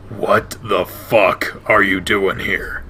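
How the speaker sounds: low, slow, hostile demand. Acted. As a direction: drag it out low and menacing. Flynn confronting Chase.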